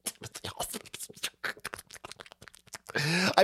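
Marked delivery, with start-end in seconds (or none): 0.1-0.9 mumbled aside. Real, not acted. Mumbling the misheard name.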